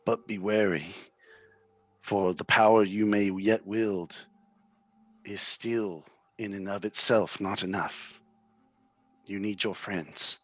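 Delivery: slow, grave seer reading a fortune; an old seer foretelling a sorcerer's fate; solemn prophecy. Acted. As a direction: intone it like an omen. Reads a vision prophecy.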